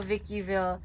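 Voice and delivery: ordinary talk, nothing marked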